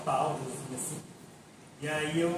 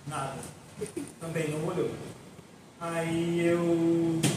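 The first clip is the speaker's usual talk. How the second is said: ordinary talk, nothing marked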